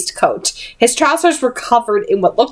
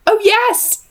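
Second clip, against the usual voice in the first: a touch of eager brightness, higher; 12 semitones higher than usual. Acted. Henry answering Will about the fire, lightly eager. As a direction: say yes keenly, pleased. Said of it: "Henry said eagerly".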